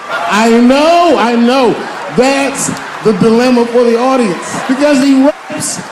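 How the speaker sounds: high, loud, slowing at the end; loud pleading defense. Real, not acted. Comic defending the bit to the groaning audience, loud. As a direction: plead the dilemma over the crowd. Defending the joke over laughter.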